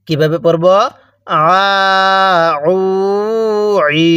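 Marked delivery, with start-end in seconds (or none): 1.3-4.1 আ, উ, ই pressed from mid-throat, held long; throaty ain. Shown as a demonstration. Teacher drills ain for learners, throat-pressed long vowels. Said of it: "গলার মাঝখানে একটু চাপ দিলে".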